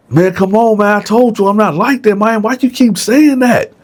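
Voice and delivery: high, whiny protest; Dylan insisting to Bone that he is not like that; protesting cellmate. Retold or imitated. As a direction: push him off with words, pleading.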